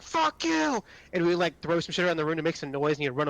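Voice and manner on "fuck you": mock yell back. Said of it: "He'd be like".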